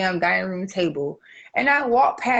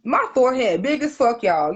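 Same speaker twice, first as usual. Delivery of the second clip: ordinary talk, nothing marked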